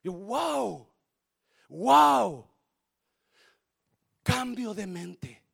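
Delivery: usual speaking voice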